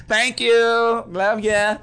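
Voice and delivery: high, sing-song thank you; playful cutesy thanks. Acted. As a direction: coo it to the listeners.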